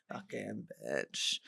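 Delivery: very low, slow mutter under the breath; mock-grumble it quietly to yourself; muttered insult.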